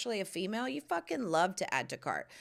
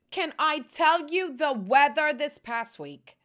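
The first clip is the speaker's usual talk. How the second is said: loud, slow, higher call-out; yelling; 6 semitones higher, 6 dB louder than usual. Real, not acted. To viewers about the English weather, yelling. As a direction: belt it out to the room. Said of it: "I know I'm yelling".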